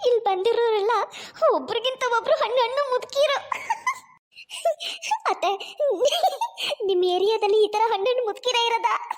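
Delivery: put-on can't stop cackling at the old ladies; cheeky giggle to her mother-in-law; giggling. Bride giggling at old visitors.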